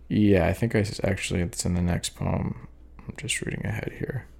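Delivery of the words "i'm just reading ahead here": whispered aside. Real, not acted. Aside while skimming the page.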